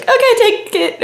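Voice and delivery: put-on a scared teen caving fast; nervous high yelp. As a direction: blurt it out to get her gone. Scared barista hands back money.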